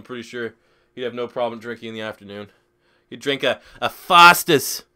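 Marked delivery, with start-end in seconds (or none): 3.1-4.8 slow, loud bad Australian accent on Foster's; terrible Australian accent. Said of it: "That's a terrible Australian accent".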